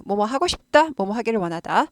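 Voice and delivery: normal talking voice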